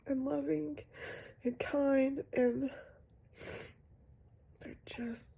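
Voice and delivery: fighting back tears while counting her blessings; tearfully. Real, not acted. Mother near tears, thankful her kids are healthy. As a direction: voice pitched high and breaking, near tears, dropping at times to a whisper.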